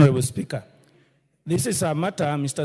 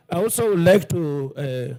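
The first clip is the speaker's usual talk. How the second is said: ordinary talk, nothing marked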